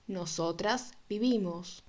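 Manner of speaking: normal talking voice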